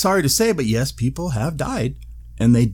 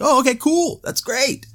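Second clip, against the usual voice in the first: relieved. Pretend. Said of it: "That would have been like".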